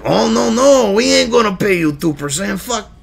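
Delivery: hammed-up French-Spanish accent; botched French accent. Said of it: "You know, that's more Spanish".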